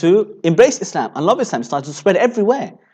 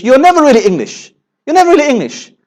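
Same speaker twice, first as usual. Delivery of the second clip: high-pitched, emphatic mock dismissal; insistent rejection; 8 semitones higher than usual. Native Briton telling an immigrant they don't belong, insistent rejection. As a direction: imitate someone firmly shutting a newcomer out.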